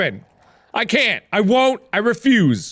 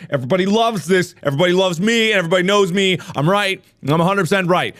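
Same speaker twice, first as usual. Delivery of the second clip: usual speaking voice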